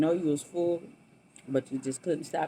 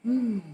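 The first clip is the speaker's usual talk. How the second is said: mmm of delight; 6 semitones higher, 4 dB louder than usual. Real, not acted. Savoring food on camera.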